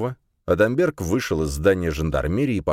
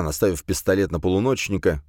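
plain reading voice

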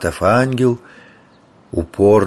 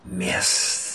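unvoiced snake hiss; hissing serpent dragon; 9 dB quieter than usual. A narrator's reading. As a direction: hiss the word like a bronze snake. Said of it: "и прошипел".

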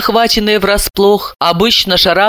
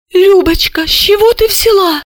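very high, alarmed little voice; frightened question; 14 semitones higher, 3 dB louder than usual. A narrator's reading. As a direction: squeak it out anxiously to your sister. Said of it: "испуганно осведомилась".

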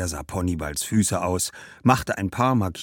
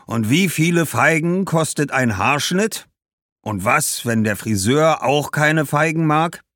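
loud, high, exasperated merchant; challenging shoemaker; 9 semitones higher, 6 dB louder than usual. Acted. Shoemaker questioning what figs would buy, exasperated. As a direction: fire back sceptical questions.

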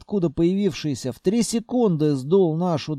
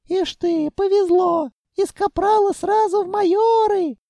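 ordinary delivery

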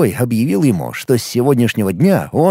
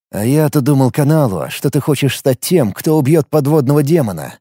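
plain reading voice

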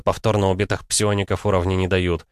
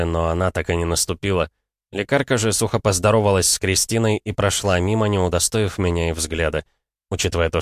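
ordinary delivery

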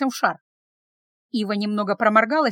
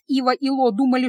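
ordinary delivery

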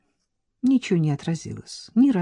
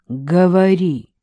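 slow, louder authoritative order; commanding; 8 dB louder, slower than usual. Acted. Man ordering his lover to go on, commanding. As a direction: demand she speak, no room to refuse. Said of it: "властно поторопил он".

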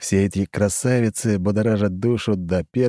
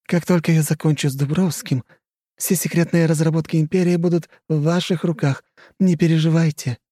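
higher, scheming society lady; coy and calculating, higher; flirty countess; 9 semitones higher than usual. Acted. Khrushcheva's voice.

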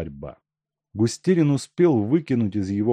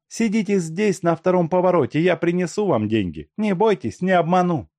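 brisk Masha; 10 semitones higher, 4 dB louder than usual. A narrator's reading. Masha instructs gang.

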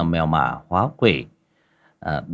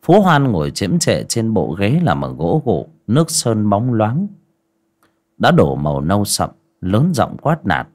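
measured storyteller voice; story narration; 6 dB louder than usual. A narrator's reading. Narrator describing the landlord on his chair, steady narration. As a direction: set the scene steadily. Narration between character lines.

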